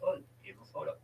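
ordinary talk

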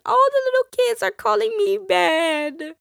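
high, lightly voiced tearful child; four-year-old telling her dad she's called bad, high child voice; crying little girl. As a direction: imitate a small girl upset. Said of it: "she starts crying and saying".

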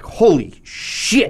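drawn out like being floored by a movie; stunned holy shit. Retold or imitated. Telling friends about an intense opening, slow mock awe. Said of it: "you're just like".

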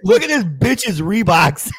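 laughing while mocking; cracking up mid-sentence. Real, not acted. Mocking sneakers in video.

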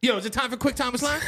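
high hyped-up announcer shout; hype announcer call. Pretend. Cohost kicks off the segment, hype announcer. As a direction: pump up the intro like a hype man.

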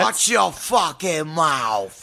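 slow, drawn-out Boston warning; mock-angry Boston tough guy, stretched out; Boston accent. Toll booth guy warns the driver, slow mock Boston accent. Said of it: "speaking in my best Boston accent".

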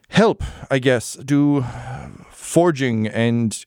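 ordinary talk, nothing marked

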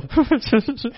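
high, mock snicker between pranksters; imitated snickering. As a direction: imitate jokesters snickering to themselves. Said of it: "they're snickering to themselves".

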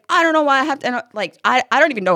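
high, loud imitation of a snapping kid; loud, high mock outburst from a tween; retold yell. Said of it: "she yelled and she was like".

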